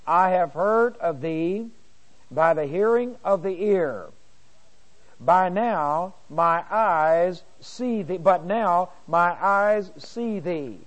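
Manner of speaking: slow, measured verse reading; scripture reading. Acted. Bible teacher reading Job to the class, slow and measured. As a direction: read it out slowly, weighing each phrase.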